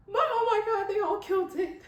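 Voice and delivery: high, thrilled but kept down; held-back excitement. Real, not acted. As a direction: squeal it without getting loud. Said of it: "I wish I could scream louder".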